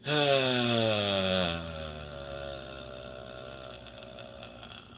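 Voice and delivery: long, slow, drawn-out tired exhale; long weary sigh. Real, not acted. After working hard all day, long weary sigh. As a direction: let all the air out, worn out.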